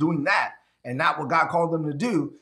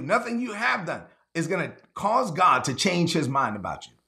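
ordinary talk

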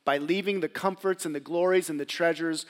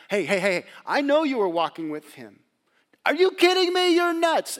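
higher, louder taunting accusation; accusing girl; 10 semitones higher, 9 dB louder than usual. Acted. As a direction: call him out sharply. Girl confronts Peter.

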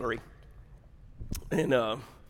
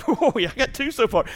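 high-pitched, laughing thrill; excited lottery player; 10 semitones higher than usual. Acted. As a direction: act out mounting lottery excitement. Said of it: "you get excited".

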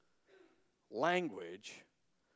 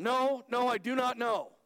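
louder, higher flustered denial; 9 semitones higher, 6 dB louder than usual. Acted. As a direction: push him off, raising your voice. Peter denies loudly.

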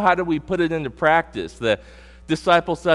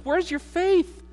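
much higher, slow and wondering; high questioning; slower than usual. Acted. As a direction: ask it high and drawn out. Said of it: "And Jesus says".

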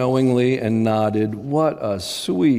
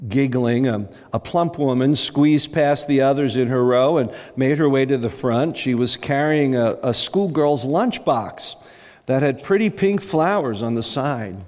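plain reading voice

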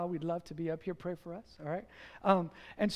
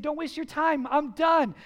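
raised, louder brush-off; pushing back; 5 semitones higher, 4 dB louder than usual. Retold or imitated. Husband refusing his friends' help, pushing back. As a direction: shut the helpers down, firmly. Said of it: "he would push back".

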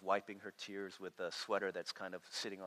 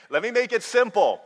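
insistent God voice; 12 semitones higher, 15 dB louder than usual. Retold or imitated.

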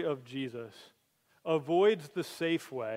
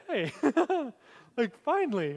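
relieved Peter; 8 semitones higher than usual. Retold or imitated. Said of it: "I just imagine Peter being like".

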